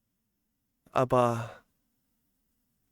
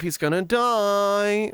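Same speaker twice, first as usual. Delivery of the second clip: 1 mock ominous; 7 semitones higher, slower than usual. Mock doom about guard.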